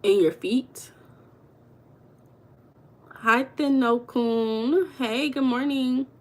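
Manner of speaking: ordinary talk, nothing marked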